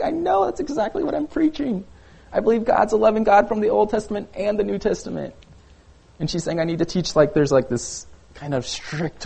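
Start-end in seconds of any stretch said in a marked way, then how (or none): 0.0-5.3 pitched way up, insisting in disbelief; exasperated protest. Retold or imitated. Pastor protesting to the critical mom, high exasperated insistence. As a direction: defend yourself, voice climbing high. Defending sermon to upset mom.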